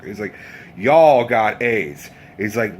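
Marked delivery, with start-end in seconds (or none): 0.8-2.1 loud, slow imitation hillbilly; hillbilly customer. Hillbilly accusing the cook, hillbilly customer. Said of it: "this one hillbilly says".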